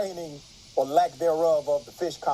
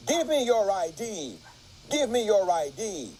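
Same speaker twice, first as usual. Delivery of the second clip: mock scream; 7 semitones higher than usual. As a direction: read out an officer's screamed demand, raised pitch. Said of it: "he's screaming at me".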